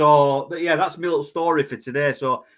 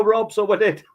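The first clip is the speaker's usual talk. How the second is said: Irish lilt, higher pitch; Irish accent; 6 semitones higher than usual. Pretend. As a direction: slip into an Irish brogue. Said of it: "A bit of Irish thrown in".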